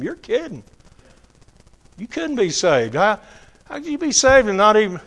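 incredulous. Retold or imitated. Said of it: "That's what I said to him".

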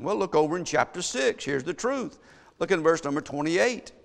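ordinary talk, nothing marked

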